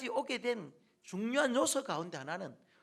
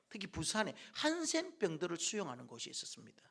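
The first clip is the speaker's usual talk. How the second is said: normal talking voice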